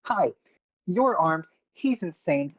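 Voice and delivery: high-pitched, fed-up character voice; reluctant accountant. Acted. Accountant begging out of a fight with armed strangers, high reluctant voice. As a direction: pitch it up, pleading to be left out of it. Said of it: "leans back and goes".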